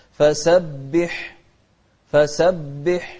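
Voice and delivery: slow فسبح ending on an airy ح; breathed haa. Shown as a demonstration. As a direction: let breath flow through the ح of فسبح. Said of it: "شوف الحاء من أحرف الهمس".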